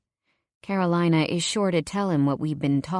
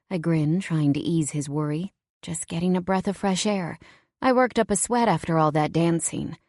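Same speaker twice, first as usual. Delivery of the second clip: plain reading voice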